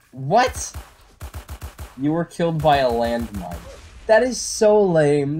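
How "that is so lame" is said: higher, slow and loud disgust; exasperated. Real, not acted. Gamer killed by a landmine, complaining slowly and loudly. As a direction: drag out your disgust at a cheap death.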